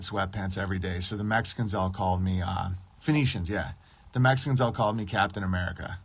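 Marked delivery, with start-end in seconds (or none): none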